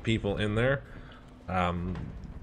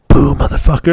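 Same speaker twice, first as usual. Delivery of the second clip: sudden very loud, higher boo; acted jump-scare shout; 5 semitones higher, 17 dB louder than usual. Friend leaning into his ear to scare him, shouting. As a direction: burst out to startle someone.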